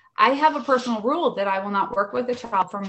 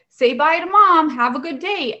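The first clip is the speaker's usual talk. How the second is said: bright brisk dismissal; 8 semitones higher, 6 dB louder than usual. Retold or imitated. Quoting herself to mom.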